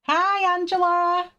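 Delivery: high, loud cheerful hi; light up greeting a friend; excited greeting. Real, not acted. Greeting viewer in chat.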